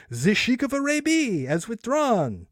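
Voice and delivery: high, slow French officer; pompous comic French soldier; French accent. Retold or imitated. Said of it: "he's like".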